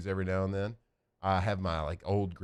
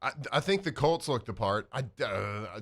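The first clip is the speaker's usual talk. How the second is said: ordinary talk, nothing marked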